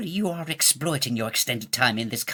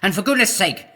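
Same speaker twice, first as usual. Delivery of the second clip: loud, high summons to a servant; calling out; 7 semitones higher, 6 dB louder than usual. Acted. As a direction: bellow across the castle for the chamberlain. King calls servant from afar.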